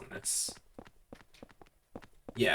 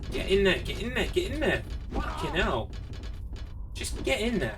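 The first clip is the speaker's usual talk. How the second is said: urgent pleading; 7 semitones higher than usual. Real, not acted. Urging the character through door.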